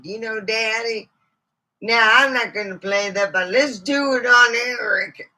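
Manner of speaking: a hint of a chatty old lady telling family stories; mildly put-on folksy lady; snake lady voice. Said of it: "Let's bring out Miss Snakers herself".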